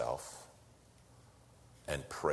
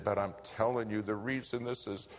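higher, choked-up retold plea; tearful plea; 6 semitones higher than usual. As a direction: voice the pastor pleading through tears. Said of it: "And he's sobbing".

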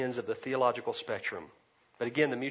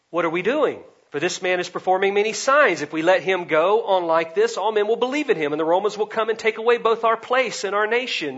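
loud, raised voice, acting out alarm; alarmed council; 7 semitones higher, 10 dB louder than usual. Preacher acting out the council fretting over the Romans, raised alarmed voice. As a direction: build higher as the worried argument mounts.

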